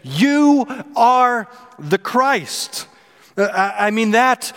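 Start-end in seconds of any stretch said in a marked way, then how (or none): 0.0-2.9 "You are the Christ" drawn out very slowly and high; slow proclamation. Retold or imitated. Peter declaring Jesus the Christ, very slow. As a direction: proclaim it like a great reveal. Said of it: "he speaks up and he says".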